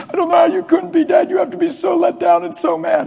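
very high, wailing son; acted tearful, strained high cry; imitated bawling. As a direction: sob the words out in distress. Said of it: "He's just bawling".

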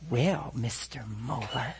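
low, slow, soft mock villain; sinister nurse. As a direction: draw it out like a cartoon villain. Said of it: "looked to me like sinister".

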